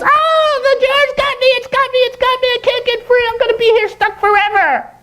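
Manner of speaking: very high squeaky monkey voice; frantic falsetto monkey cries for help; panicked monkey. Acted. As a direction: squeal in alarm as a trapped little monkey. Monkey trapped again in story.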